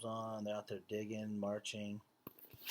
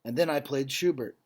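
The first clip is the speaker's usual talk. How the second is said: solemn, louder read-aloud voice; story narration; 5 semitones higher, 12 dB louder than usual. A narrator's reading. Teacher reading a Holocaust story to the class, grave narration. Reads picture book to class.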